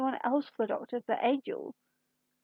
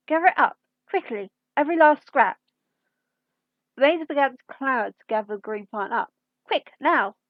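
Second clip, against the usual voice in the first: louder, brisk command to the maids; urgent orders; 6 dB louder than usual. Acted. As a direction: hurry the servants along, loudly. Cook orders maids to hurry.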